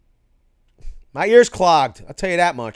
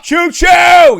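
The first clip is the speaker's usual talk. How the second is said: high, loud whoop of a train call; real excited shout, much higher and louder; excited choo choo shout; 13 semitones higher, 14 dB louder than usual. Real, not acted. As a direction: holler it like a train pulling in. Cheering a viewer's funny comment.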